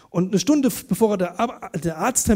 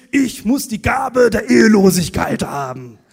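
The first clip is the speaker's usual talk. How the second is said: pious declaration; 2 semitones higher, 9 dB louder than usual. Retold or imitated. Boy's pious excuse.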